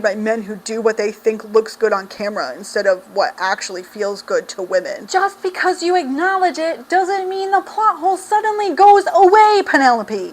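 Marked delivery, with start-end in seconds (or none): 5.1-10.3 higher, rising to a louder, fed-up jab; exasperated retort. Real, not acted. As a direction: call the author out on the plot hole, exasperated. Reviewer scolding the author.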